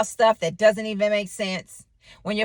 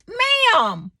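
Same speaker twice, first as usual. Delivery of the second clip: high, strained and louder; exasperated, pitched-up plea to move; agitated demand; 5 dB louder, much slower than usual. Retold or imitated. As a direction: snap at the lady blocking the parking spot. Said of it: "I was a little bit agitated".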